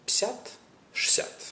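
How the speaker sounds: пятьдесят squeezed to псят, шестьдесят to шсят; extreme clipping. Shown as a demonstration. Teacher showing the most clipped casual forms. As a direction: squash each to a single quick syllable. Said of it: "or even".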